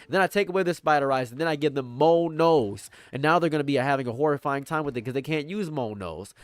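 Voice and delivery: ordinary talk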